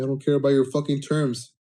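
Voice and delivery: plain reading voice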